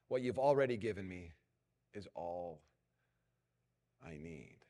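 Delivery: pastor leading the room in prayer. Shown as a demonstration. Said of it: "leads prayer".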